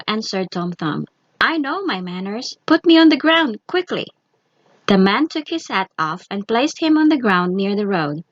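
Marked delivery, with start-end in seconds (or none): 1.4-4.1 insistent demand. A narrator's reading. Tom insists.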